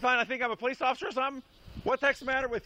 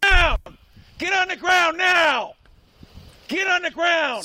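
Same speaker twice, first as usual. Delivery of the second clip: shouting; 5 semitones higher, 5 dB louder, slower than usual. Real, not acted. Police shouting commands at suspect.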